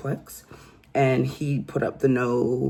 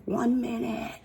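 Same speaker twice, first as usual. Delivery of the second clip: high-pitched mock scream of One minute; 9 semitones higher, 3 dB quieter than usual. Woman yelling at cop through the door, mock scream. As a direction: screech One minute playfully. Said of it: "she screams ... at the top of her lungs".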